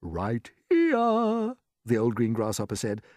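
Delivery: high, slow, drawn-out grasshopper; teasing old grasshopper. A narrator's reading. Grasshopper revealing ears to James, slow and high. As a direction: stretch it out with relish.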